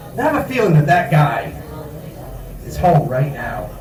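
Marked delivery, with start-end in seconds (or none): none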